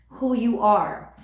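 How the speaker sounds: ordinary delivery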